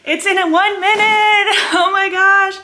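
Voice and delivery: high-pitched giddy squealing with laughter; freaking out. Real, not acted. Vlogger one minute from launch, freaking out. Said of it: "I'm freaking out".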